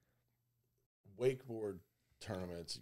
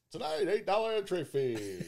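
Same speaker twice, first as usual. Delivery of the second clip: higher, louder showman's call; loud carnival barker; 7 semitones higher, 4 dB louder than usual. Pretend. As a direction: bark it out like a fairground barker. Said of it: "doing the old Carnival Barker voice".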